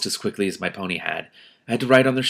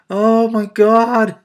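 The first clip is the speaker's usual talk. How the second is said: high, loud, drawn-out disbelief; slow shocked oh my God; 13 semitones higher, 9 dB louder, much slower than usual. Real, not acted. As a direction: stretch it out in shock. Disbelief after shouting.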